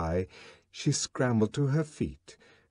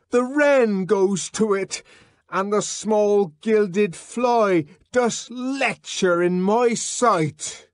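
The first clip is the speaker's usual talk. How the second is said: higher, louder, slow declamation; raving savage reciting; 12 semitones higher, 7 dB louder, slower than usual. Acted. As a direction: chant the verses like a madman. John ranting Shakespeare.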